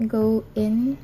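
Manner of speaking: ordinary delivery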